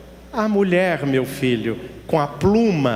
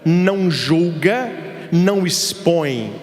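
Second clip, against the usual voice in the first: normal talking voice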